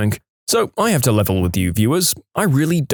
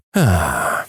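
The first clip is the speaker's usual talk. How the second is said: long falling exhale; weary sigh; 6 semitones lower than usual. A narrator's reading. Narrator ending a story about being mistaken for a woman, sighing. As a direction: let out a low sigh.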